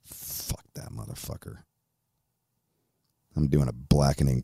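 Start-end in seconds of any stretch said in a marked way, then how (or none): 0.0-1.6 breathed under the breath, bitter; muttered curse. Real, not acted. Host cursing tyrants to himself, in a soft breathy mutter. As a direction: spit it out almost silently.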